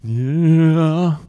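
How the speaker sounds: loud, high, drawn-out sheepish admission; squirming, long-held confession; embarrassed. Retold or imitated. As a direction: stretch it out in mock embarrassment. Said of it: "He's, like, all embarrassed".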